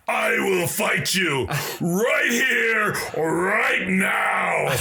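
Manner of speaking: booming mock war cry; roaring challenge. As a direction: roar it out like a giant claiming a fight. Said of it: "bigger than all of our voices roar out".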